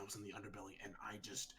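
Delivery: breathy unvoiced whisper. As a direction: whisper your worry to the group. Whispering fears to party.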